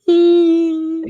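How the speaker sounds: high, loud eerie moaning. Retold or imitated.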